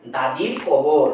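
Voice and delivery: দাদির কবর drawn out slowly as one unit; slow recited phrase. Shown as a demonstration. Teacher marking a foot of the poem, slow and deliberate. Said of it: "এটা একটা পর্ব".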